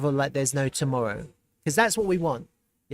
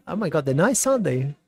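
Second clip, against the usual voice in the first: usual speaking voice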